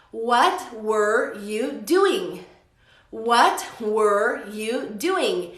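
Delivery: very slow, word by word; slow model question. Shown as a demonstration. Teacher models the question with doing, very slowly. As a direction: leave space round every word.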